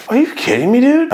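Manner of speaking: high, loud disbelief; exasperated outburst. Retold or imitated. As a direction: blurt it out, fed up. Exasperated at girlfriend.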